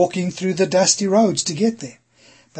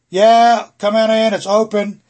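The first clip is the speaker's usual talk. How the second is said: loud casual call, higher pitched; yelling from the kitchen; 5 semitones higher, 7 dB louder than usual. Shown as a demonstration. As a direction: call out from another room without getting up. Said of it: "you don't just yell from the kitchen".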